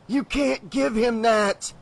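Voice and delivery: Breaker's urgent warning. Acted. Breaker warns the sheriff against the salesman, urgently. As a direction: press the warning out slowly. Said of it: "Breaker said".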